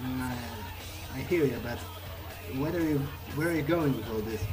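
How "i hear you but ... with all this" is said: ordinary delivery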